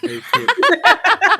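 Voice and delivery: high laughing squeal. Real, not acted. Laughing at not being able.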